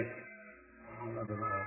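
very soft, slow hearing-test whisper. Shown as a demonstration. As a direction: whisper it slowly, barely audible. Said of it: "I'm gonna whisper to him".

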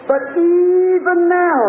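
high, drawn-out preacher's cry; acted outcry of faith; fervent plea.

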